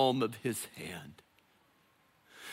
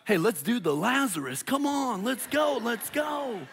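party hype; 8 semitones higher than usual. Pretend.

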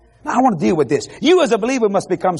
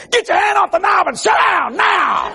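high, slow shouted order; commanding shout; 11 semitones higher than usual. Retold or imitated. Preacher commanding the husband through the TV, slow high shout. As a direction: bark it down from the screen like a stern preacher. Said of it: "I just happened to say".

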